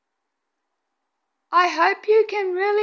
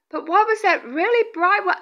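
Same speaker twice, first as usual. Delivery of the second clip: usual speaking voice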